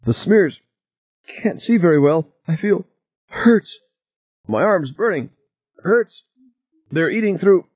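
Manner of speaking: panicked gasping, high and strained; hyperventilating. A narrator's reading. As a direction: gasp out the words in pain and panic. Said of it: "Edmonds's breathing was coming in ragged gasps".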